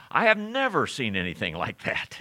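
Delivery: everyday emphasis landing on "never"; stress on NEVER. Shown as a demonstration. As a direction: hit "never", let the rest fall away. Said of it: "we emphasize a word".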